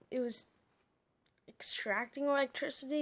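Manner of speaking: plain reading voice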